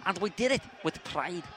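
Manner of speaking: low, slow Scouse footballer interview; Jamie Carragher. Acted. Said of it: "Jamie Carragher's up here".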